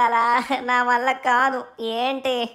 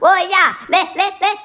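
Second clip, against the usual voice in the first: loud wake-up call; 5 semitones higher, 4 dB louder than usual. Acted. Younger brother yelling to wake sleeping Dhanu, loud. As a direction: yell right in his ear to wake him.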